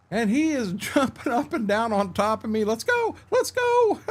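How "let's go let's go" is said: high, squeaky pleading, imitating his own panic; a hammed-up cry for the dog to get off; mock frantic plea. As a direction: playfully act out being pinned down and begging. Dog jumping on him, retold.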